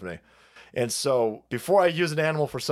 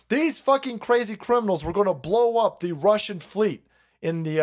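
ordinary delivery

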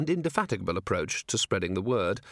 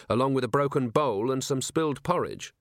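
ordinary delivery

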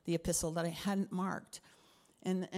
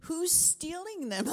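incredulous; 11 semitones higher, slower than usual. Retold or imitated. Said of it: "And I'm like".

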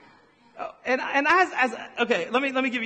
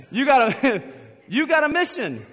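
laughing emphasis; 5 semitones higher, 5 dB louder than usual. Real, not acted. Repeats line laughing, emphatic.

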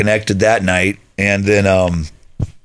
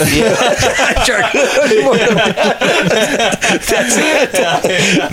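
slow, high words broken by laughter; cracking up at the friend's joke; laughing; 14 semitones higher, much slower than usual. Real, not acted.

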